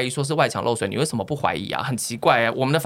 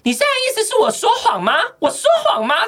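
hammed-up shrill indignant retort, much higher; mock furious outburst; 6 dB louder than usual. Neighbor woman yelling at her accuser, hammed-up fury. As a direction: imitate an enraged neighbor, high and loud. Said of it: "那个女的就直接暴怒说".

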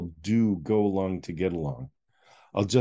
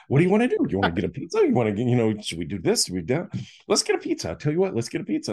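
much higher, bouncy rapid-fire pizza offers; over-eager brother pushing pizza on his gloomy sibling; hyper puppy; 7 semitones higher, much faster than usual. Retold or imitated. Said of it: "super hy-, hyper, like a little puppy".

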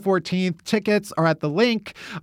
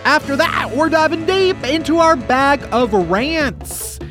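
higher, drawn-out segment tease; show announcer; 6 semitones higher, slower than usual. Pretend. Host announcing the rant segment, hyped announcer. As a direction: build it up like a game-show announcer.

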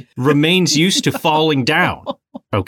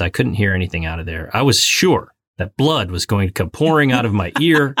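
ordinary delivery

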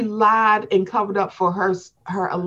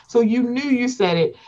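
normal talking voice

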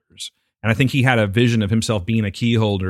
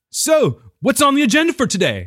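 overexcited barista; 13 semitones higher, 3 dB louder than usual. Retold or imitated. Imitating barista at window.

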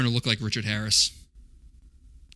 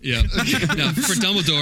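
usual speaking voice

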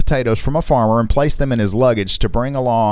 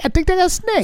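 much higher, hammed-up Indian accent; bad Indian accent. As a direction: squeak it out as a cartoonish Indian accent. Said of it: "I can't do an Indian accent".

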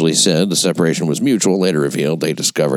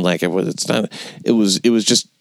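normal talking voice

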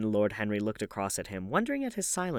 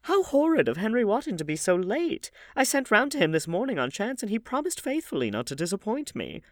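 put-on high, louder outburst from the old lady; exclaiming; 12 semitones higher, 4 dB louder than usual. A narrator's reading. Hostess complaining that Henry is late, high and exclaiming. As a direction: exclaim in mock indignation.

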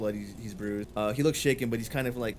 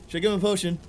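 raised, higher voice calling over; louder, pitched-up shout across a distance; 7 semitones higher, 5 dB louder, faster than usual. A narrator's reading. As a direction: call it urgently to a companion. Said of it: "he kinda shouts out to you".

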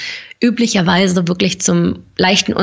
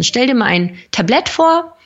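usual speaking voice

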